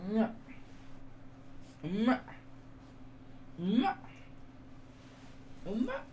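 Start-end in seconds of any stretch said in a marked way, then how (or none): 1.9-6.0 higher and higher air kisses; kiss sounds. Real, not acted. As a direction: blow kisses at the dog. Kissing the puppy.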